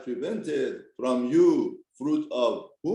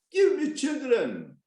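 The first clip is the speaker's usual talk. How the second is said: pleading demand; 10 semitones higher, slower than usual. Acted. Rachel's plea to Yaakov.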